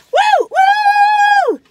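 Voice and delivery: very high, loud woo-hoos; shrieking woos of delight; joyful whoops. Real, not acted. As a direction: let the cookie joy explode. More cookie whoops.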